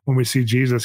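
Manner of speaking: usual speaking voice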